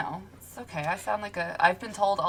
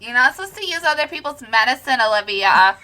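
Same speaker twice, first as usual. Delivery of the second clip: mock scolding; 6 semitones higher, 9 dB louder, faster than usual. Real, not acted. Mock scolding while laughing.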